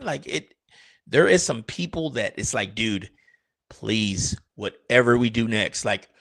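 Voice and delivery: ordinary delivery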